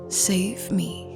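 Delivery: ordinary delivery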